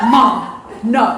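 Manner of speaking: loud mock groan of refusal; flat-out refuse, hammed up; son refusing. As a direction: son refusing to pull up at Goodwill.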